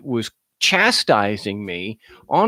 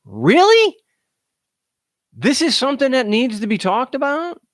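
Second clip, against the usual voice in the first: high, incredulous "Really?" with scorn; sarcastic disbelief; 11 semitones higher than usual. Shown as a demonstration. Mocking a silly topic, sarcastic high disbelief. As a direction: scoff at the question as absurd. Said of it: "It's a sarcasm thing".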